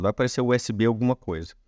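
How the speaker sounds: ordinary delivery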